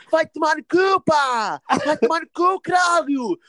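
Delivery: high, slow mock yelling; hammed-up angry cursing, pitched high; imitating cursing relatives. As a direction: shout the curses like a feuding family. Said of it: "they'd be like".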